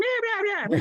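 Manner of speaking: very high squealed impression; Richie Menavini. Shown as a demonstration. Everybody impersonates the comedy godfather, high-voiced impression. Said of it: "He does that high voice".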